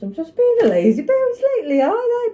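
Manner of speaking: very high, loud sing-song; playful self-mockery. Real, not acted. Vlogger teasing herself about a lazy week, high mock-squeal.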